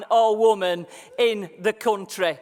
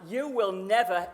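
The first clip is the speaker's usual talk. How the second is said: normal talking voice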